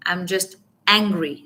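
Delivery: angry set apart and pushed harder; stress on angry. Shown as a demonstration. Coach shows how to use it, stress on angry. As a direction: hit the word angry.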